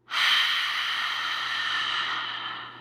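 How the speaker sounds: very long, airy exhale fading away; empty the lungs in one slow sigh; deep sigh out. Shown as a demonstration. Guide on the last breath of the round, long deep sigh. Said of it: "with a deep sigh out".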